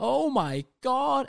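loud, high, drawn-out gushing; hammed-up thrill; mock amazement. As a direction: stretch "oh my god" in over-the-top awe. Mock excitement over Christmas medister.

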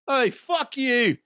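high, slow, hammed-up Irish holler; cartoon Irish insult, stretched out; mock Irish accent. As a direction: belt out a bad Irish brogue. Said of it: "what would that sound like if it's Irish".

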